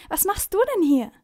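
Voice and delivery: put-on boy calling out to the Sandman. A narrator's reading. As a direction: shout his name across the shop. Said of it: "ruft Finn".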